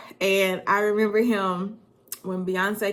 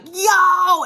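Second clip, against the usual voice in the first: loud high yell of yo; calling out to a friend, hyped; shouted greeting; 12 semitones higher, 12 dB louder than usual. Retold or imitated. Friend calling back, excited loud yo. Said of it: "and he's like".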